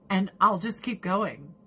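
ordinary talk, nothing marked

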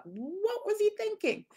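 high, drawn-out disbelief; incredulous. Real, not acted. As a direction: stretch it out high, appalled at the stupidity. Reacts to stranger's reckless reaching.

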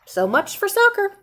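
ordinary delivery

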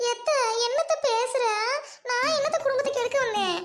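a hint of a wounded bride protesting; offended daughter-in-law. Wife answering mother-in-law in mock offence.

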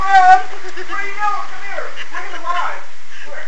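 very high voice for a cat; cat talk voice. Real, not acted. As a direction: coo at the cat in falsetto. Talking to the cat.

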